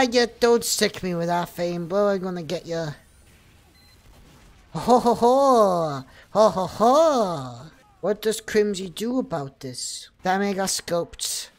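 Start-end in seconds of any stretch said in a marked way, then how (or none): 4.7-7.7 loud, drawn-out "ho ho ho"; belly out a mock villain laugh; hammed-up laugh. Streamer gloating during the game, hammed-up laugh.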